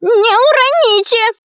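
high, drawn-out witchy wail; anxious witch's high cry. A narrator's reading. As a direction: lament in a high, slow witch voice. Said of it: "и причитала".

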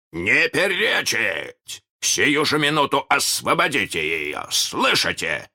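высокий властный окрик; пресеки возражения приказом; крик. A narrator's reading. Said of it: "кричал Питер". (повелительный крик)

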